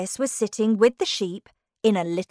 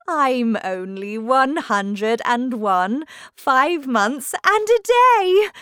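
Queen stating her age; 3 semitones higher, 5 dB louder, slower than usual. Acted. Queen tells Alice her age, slowly and grandly. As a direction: draw it out, building to the end.

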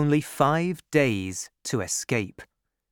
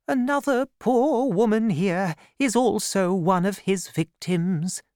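put-on high, gentle old nun voice; kindly abbess; 8 semitones higher than usual. A narrator's reading. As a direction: speak as a caring abbess. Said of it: "the abbess told her".

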